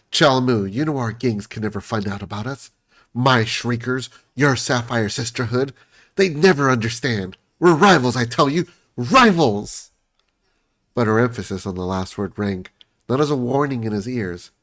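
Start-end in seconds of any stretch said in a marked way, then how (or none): 0.1-9.9 rising from pleading to a loud cry of rivals; melodrama, growing louder to the end; dramatic lover, building. Acted. Tantra crying out to her secret lover, dramatic.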